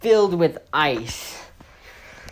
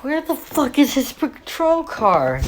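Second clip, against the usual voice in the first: higher, baffled shout-like question; baffled cops; 10 semitones higher than usual. Retold or imitated. Cops watching colleague run, higher baffled yelp. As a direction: squeal it like a confused cop. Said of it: "And they're like".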